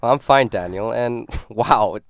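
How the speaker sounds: ordinary talk, nothing marked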